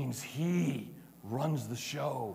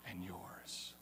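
whispered close; 7 semitones lower, 18 dB quieter than usual. Acted. Jesus promising his Father is yours, whispered. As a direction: drop to a whisper. Closing quote trails to whisper.

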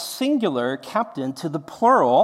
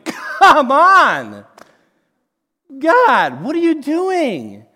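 loud, high, outraged complaint at God; exasperated shout; 8 semitones higher, 9 dB louder, much slower than usual. Acted. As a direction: throw up your hands and yell it. Jonah's exasperated reaction.

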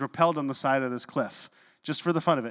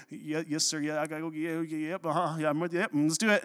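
softer, rambling, jittery imitation; nervous agreeing; 4 dB quieter than usual. Trembling cadet to instructor at cliff edge, nervous agreeing. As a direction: babble agreement under your breath to psych yourself up.

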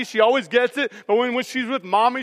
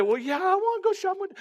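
put-on high, fast little-girl voice, softer; imitating his toddler; 9 semitones higher, 6 dB quieter, faster than usual. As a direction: chirp it back like an eager three-year-old. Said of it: "we ask her".

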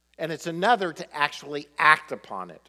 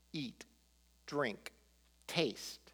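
very soft single words, set apart by pauses; hushed, tender commands, one word at a time; gentle invitation; 12 dB quieter, much slower than usual. Acted. Preacher echoes God's call to eat, drink and taste, very softly.

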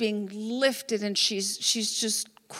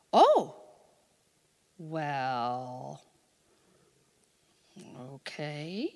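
startled oh, then low, drawn-out reluctance; imitating someone awkwardly agreeing; hesitant acceptance; 11 semitones lower, 5 dB quieter, much slower than usual. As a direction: act out a wary, uncertain agreement, trailing into quiet. Acting wary reply to prayer offer.

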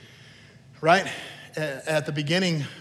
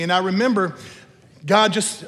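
ordinary talk

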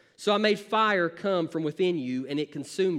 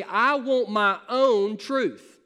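slow, louder, exaggerated mock of a defiant person; mocking rebel; 3 semitones higher, 4 dB louder, much slower than usual. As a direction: sneer it out like a spoiled rebel. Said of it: "a group of people that say".

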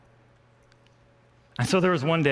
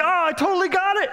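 much higher, louder gleeful cry; triumphant; 6 dB louder than usual. Retold or imitated. Hunter sure he hit the deer, high excited cry. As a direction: crow that you nailed the shot.

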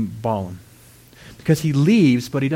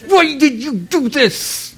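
high, slow, loud wail of outrage; hammed-up furious demand, dragging the words; mad king; 12 semitones higher, 5 dB louder, much slower than usual. King confronts Balaam over the blessing, mad. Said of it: "He was mad".

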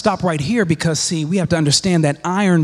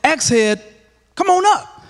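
higher, mock-easy summons of the axe head; playful command; 11 semitones higher than usual. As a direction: call the axe up like it's nothing. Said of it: "He could have easily said".

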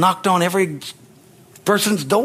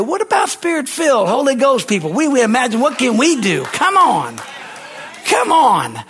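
rousing preacher climax; 6 semitones higher than usual. Real, not acted. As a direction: fire up the congregation, climbing.

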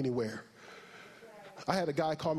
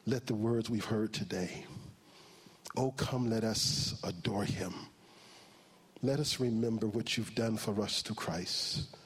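closing prayer; 6 semitones lower than usual. Acted.

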